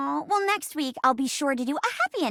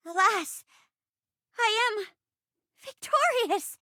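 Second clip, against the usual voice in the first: humble wood sprite; 6 semitones higher than usual. Acted.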